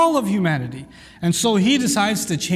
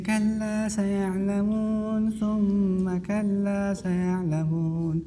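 put-on soft child reciting; 7 dB quieter than usual. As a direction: imitate a sweet child's gentle chanting. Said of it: "they're so soft in their voice".

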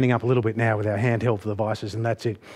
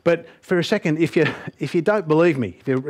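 usual speaking voice

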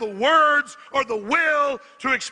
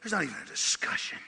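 low, soft and tired; quiet weary reflection; 9 semitones lower, 9 dB quieter, faster than usual. Real, not acted. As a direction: murmur it after the storm. After the rant, quiet reflection.

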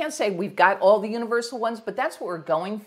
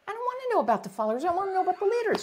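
much higher, fast petulant inner voice; inner complaint; 11 semitones higher, much faster than usual. Retold or imitated. Reader arguing with the book's authors, high and fast. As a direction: whine it to yourself quickly. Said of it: "And I'm thinking".

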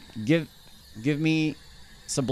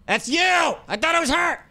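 high, loud startled yell; startled shout; 4 dB louder than usual. Real, not acted. Mistaking teammate Yui for the ghost, startled shout. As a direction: jump and shout at the fright.

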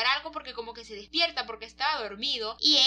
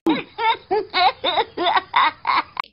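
real laugh, loud and bright; cracking up at the joke; 7 semitones higher, 11 dB louder than usual. Real, not acted. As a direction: burst out in a big loud laugh. Laughing at his own joke.

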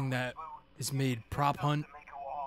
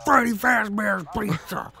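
slow, loud, higher announcer call; 8 semitones higher, 10 dB louder, slower than usual. Retold or imitated. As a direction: boom it like an ad announcer. Announcer voice for game title.

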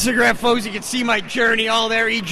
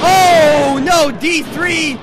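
loud, slow drawn-out oh no; surprised shout; 5 semitones higher, 5 dB louder, much slower than usual. Real, not acted. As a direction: react to the sudden turn. Sudden reversal in the match.

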